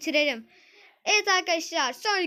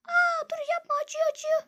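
put-on high girl's voice, crying out in pain; 12 semitones higher than usual. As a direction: yelp like a girl with a sore leg. Zeynep's sore leg is pressed.

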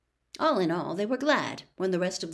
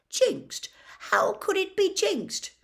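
much higher, squeaky outrage; appalled shock; 14 semitones higher, 3 dB louder, slower than usual. Acted. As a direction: recoil in horror at the word. Wood appalled at jinx idea.

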